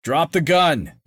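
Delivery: high, loud barked order; park ranger ordering a man to drop his gun; barked command. A narrator's reading. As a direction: bark it with authority. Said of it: "a stentorian voice barked".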